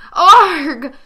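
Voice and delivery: loud high waking cry; startled yell. Acted. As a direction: yelp out of a nightmare. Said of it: "he yelled as he jolted".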